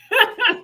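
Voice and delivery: a short high burst of laughter; quick real laugh, up high; bright laugh. Real, not acted. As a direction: laugh at your own joke. Laughs at own joke.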